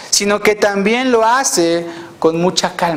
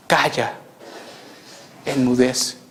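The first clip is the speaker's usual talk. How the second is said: low, soft, unhurried command; calm and tranquil; 9 semitones lower, 7 dB quieter than usual. Retold or imitated. Our Lord heard saying it to the storm, calm and low. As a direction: hush it with quiet composure. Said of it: "calmado, tranquilo, diciendo".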